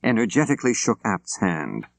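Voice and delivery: usual speaking voice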